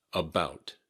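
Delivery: "About." with a crisp final T; clearly pronounced T. Shown as a demonstration. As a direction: finish "About." on an audible T. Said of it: "Be sure to pronounce the T".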